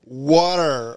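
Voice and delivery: a mock American "Water" said as "wah-der"; a hammed-up American "Water"; American accent. Shown as a demonstration. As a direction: drawl "Water" the American way, flapped t. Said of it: "This is an American impression".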